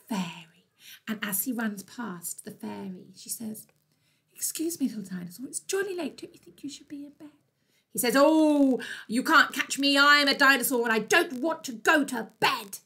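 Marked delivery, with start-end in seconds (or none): 4.3-7.3 small, high, soft fairy voice; polite fairy. Acted. Fairy to dinosaur running past, high gentle chiding. 8.2-12.8 loud, stroppy refusal of bedtime; defiant little dinosaur. Acted. Dinosaur answering the fairy, loud defiant taunt.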